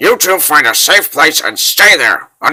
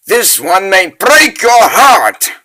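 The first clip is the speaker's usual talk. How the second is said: menacing threat; 6 semitones higher, 5 dB louder, slower than usual. Acted. Villain threatens with a spell.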